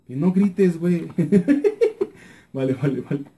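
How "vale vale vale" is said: ordinary delivery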